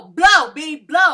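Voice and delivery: shouted, punchy challenge; loud threat. Retold or imitated. Mariah Lynn threatening a castmate, loud. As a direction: bark it like you mean it. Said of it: "Mariah Lynn was like".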